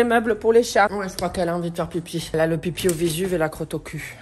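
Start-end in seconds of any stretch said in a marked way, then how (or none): none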